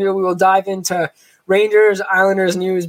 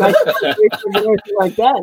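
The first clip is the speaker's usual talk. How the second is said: laughing; 5 semitones higher than usual. Real, not acted. Teasing the guest about his goals.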